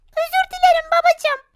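high, meek apology; high child voice. Acted. Scolded child apologizing to father, high voice. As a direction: squeak out a sorry.

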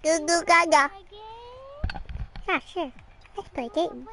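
loud, menacing clown taunt; booming horror clown voice; creepy clown voice. Pretend. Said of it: "my creepy clown voice".

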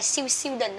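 "student" garbled as "siêu siêu đình", the t dropped; quick Vietnamese syllables, "siêu siêu đình" for "student"; learners' mispronunciation. Retold or imitated. Teacher imitates learners misreading student, "siêu siêu đình". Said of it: "Nhiều bạn có đọc là".

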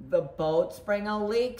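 ordinary delivery, nothing marked

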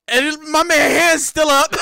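high, loud, about to crack up; incredulous shout tipping into laughing; amused disbelief. Real, not acted. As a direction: cry out, bursting into laughter. Cracking up at the fighter's pose.

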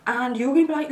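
ordinary delivery

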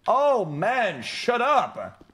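fed up, let it burst out; exasperated shut up. Real, not acted. Streamer fed up with merch critics, exasperated outburst.

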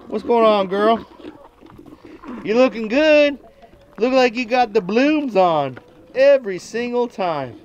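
high, sweet pet voice; talking to the goat. Real, not acted. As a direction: coo compliments up high at the goat. Complimenting the goat.

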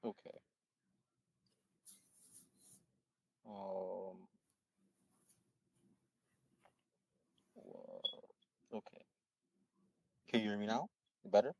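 faint, far-off voice, hard to hear; barely audible. Real, not acted. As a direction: speak as if the mic is too far away. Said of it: "It's, it's really much the same".

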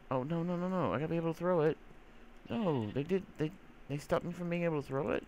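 high whiny protest; real dismay, voice up high. Real, not acted. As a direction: complain in disbelief. Ender pearl blocked, dismayed.

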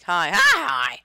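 loud squeaky voice-crack imitation; exaggerated pubescent voice cracks; voice cracking demo. Shown as a demonstration. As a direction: let it jump up and crack on the repeats. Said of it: "My voice is like".

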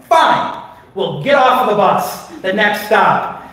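loud, high exasperated outburst, slowing at the end; angry giving in. Retold or imitated. Wife conceding to leave the bus, angrily. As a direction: explode on the first word, then spell it out.

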